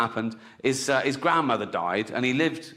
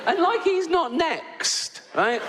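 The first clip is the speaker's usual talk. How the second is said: laughing; slower than usual. Real, not acted. Gleeful aside.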